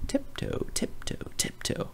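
whispered tiptoe tiptoe tiptoe; hushed, creeping whisper; sneaky whisper. A narrator's reading. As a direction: breathe each tiptoe like sneaking up. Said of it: "He sneaked up behind".

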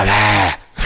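low, dry mock no; a sour little no from the have-not; imitated grudging no. Mimicking the have-not's reply.

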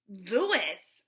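loud, disbelieving outburst on a name; incredulous exclamation. A narrator's reading. Joy balks at showing Louis the money, incredulous exclamation. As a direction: blurt it out loudly in disbelief. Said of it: "Joy exclaimed".

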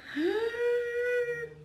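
high gasp of delight; excited gasp. Real, not acted. Excited over shrimp.

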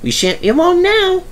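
very high-pitched, cheerful character voice; happy chuntering. A narrator's reading. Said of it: "he chuntered happily".

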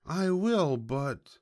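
high piglet voice, dropping on the last word; stalling piglet. A narrator's reading. Piglet stalling the hungry fox, high and resigned. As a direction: give in high, then hesitate. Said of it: "sighed the pig".

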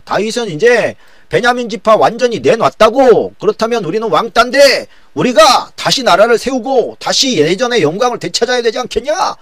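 loud, high-pitched rallying cry; rabble-rousing. Acted. Sheba inciting northern Israelites against David, rabble-rousing shout. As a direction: stir up a crowd, pitched high. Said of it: "북이스라엘 사람들을 선동하기 시작합니다".

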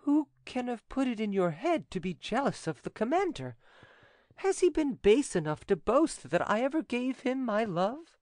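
high, wheedling heroine voice; coaxing. A narrator's reading. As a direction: coax him in a lightly acted high pitch. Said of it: "asked him in a coaxing tone".

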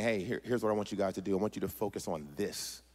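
low, soft and intimate; hushed voice of God. Acted. God directing a parent, hushed and low. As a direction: speak quietly, as if confiding. God speaking privately to someone.

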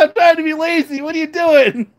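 high, laughing cat voice; voicing the cat. Owner voicing her lazy cat, high and laughing. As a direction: squeak the cat's lazy complaint through giggles. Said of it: "She's like".

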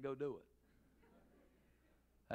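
lightly voiced patient pastor; pastor's gentle instruction. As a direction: ease off into a calm pastor. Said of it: "and then as a pastor".

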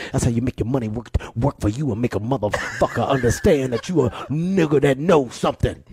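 Dick Gregory impression. Comedian quoting the elder's money lecture, lightly gruff. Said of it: "he's like".

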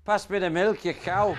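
put-on Irish husband asking his wife for milk, loud Irish accent. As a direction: bark it across the table in Irish. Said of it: "the Irish guy says".

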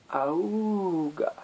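high droning bagpipe squawk; bagpipe honk. Acted. Squeezed bagpipe answers the turtle with a high honking drone. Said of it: "and the bagpipe said".

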